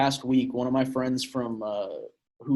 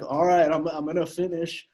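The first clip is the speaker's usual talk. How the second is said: lightly voiced urgent buddy, a bit higher; reenacting buddy's warning; 5 semitones higher, 3 dB louder than usual. Retelling a friend's hookup, mock urgent warning. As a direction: act out the buddy announcing he's about to finish, half-joking. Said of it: "I'll reenact it".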